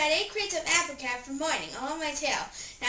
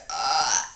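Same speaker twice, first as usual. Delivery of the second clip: loud burp; 10 semitones lower, 6 dB louder than usual. Acted. Burping contest, burps.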